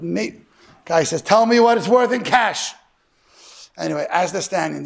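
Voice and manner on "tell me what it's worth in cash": louder, higher, slow insistent demand; blunt demand. Retold or imitated. Secular grandson cutting off the storekeeper, a slow loud demand. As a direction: lay it down flat, no more arguing.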